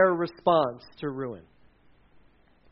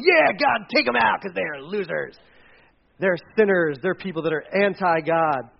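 gloating Jonah. Acted. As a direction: cheer on the destruction with spite. Jonah cheering city's destruction.

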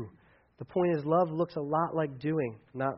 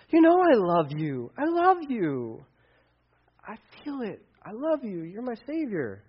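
slow, much higher, pleading devotion; earnest profession; 5 semitones higher than usual. Acted. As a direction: pour out love, slow and sincere. Peter professing love to Jesus.

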